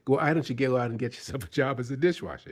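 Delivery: loud, rapid angry dismissal; retold anger. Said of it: "he's really pissed".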